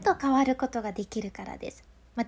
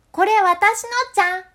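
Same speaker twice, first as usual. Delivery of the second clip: much higher and much louder, spraying out with no aim; scattered loud voice; 8 semitones higher, 10 dB louder than usual. Shown as a demonstration. Claiming the pudding to family, loud unfocused blast. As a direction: blast it in every direction like a shower. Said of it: "声がシャワーみたいに拡散しちゃってる".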